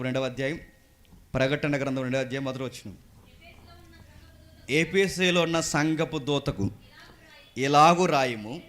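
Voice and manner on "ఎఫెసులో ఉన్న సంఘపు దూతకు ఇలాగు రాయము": loud, drawn-out declaiming of scripture; emphatic scripture reading. Acted. Preacher reading Revelation to the congregation, loud and emphatic. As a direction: proclaim each phrase, building higher.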